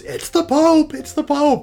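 put-on excited little kid spotting someone famous; little kid exclaiming. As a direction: pipe up high and loud like an amazed kid. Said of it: "this little kid looks up and goes".

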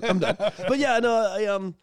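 ordinary delivery

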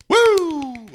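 high loud whoop; high cheer woo. Real, not acted. Celebrating after the segment.

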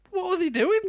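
high mock incredulity; incredulous. Real, not acted. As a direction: squeal it in amused disbelief. Mocking the odd pose.

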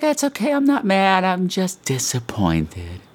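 high martyr voice dropping slow at the end; imitating mom. Mother forgiving her late caller, high then slow. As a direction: sigh it out like a hurt mother. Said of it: "She's like".

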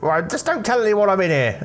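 put-on a jittery teen hiding in a warehouse; nervous teenage lad. Jimmy pleads secrecy.

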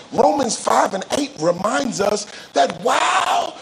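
ringing preacher cadence; impassioned preaching. Real, not acted. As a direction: preach it with urgency and lift. Preaches with raised pitch.

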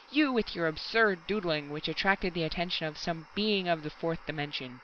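usual speaking voice

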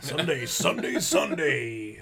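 booming arena-ad hype; monster truck announcer. Acted. Host parodying monster truck ads, hammed-up announcer voice. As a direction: stretch it out like a truck rally ad. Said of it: "monster truck announcers".

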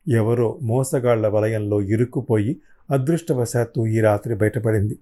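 ordinary delivery

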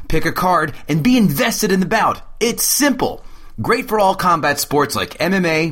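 loud, punchy sponsor read. Acted. Host reading the fight game sponsor spot, loud and punchy. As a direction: sell the card game hard to fans.

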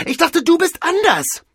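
very high, loud accusation; angry reproach. Acted. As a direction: throw the disappointment at him at full volume. Mavis reproaches Cordell. (lauter, aufgebrachter Vorwurf)

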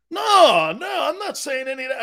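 high, loud denial; retold protest. Grandfather denying he suspected the guests, high protest. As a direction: cry out the first no, then insist.

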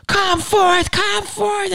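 very high, put-on shooting-scene voice; high mock hero daring the enemy; mock theatrical. Actor retelling his shootout scene, high mock voice. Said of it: "And I'm like".

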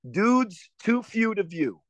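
slow Baltimore accent try, drawn out. Shown as a demonstration. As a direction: sound out each word in a Baltimore accent. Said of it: "I really wanna be able to do the Baltimore accent".

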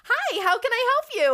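high, bright, loud service greeting; chirpy fake-friendly cashier voice; customer service voice. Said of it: "my customer service voice".

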